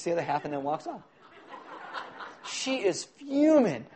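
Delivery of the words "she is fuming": mock fuming. A narrator's reading. Narrating angry woman in story.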